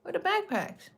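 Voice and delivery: high, teasing "Where are the backpacks?"; playful sing-song. Real, not acted. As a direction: lilt the question way up playfully. Repeats the search question playfully.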